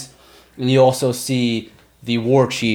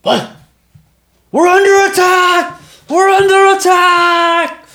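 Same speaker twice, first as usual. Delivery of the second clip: much higher, louder panicked yell; orc alarm shout; 7 dB louder, slower than usual. Acted. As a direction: shout the alarm to the whole camp. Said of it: "and he goes".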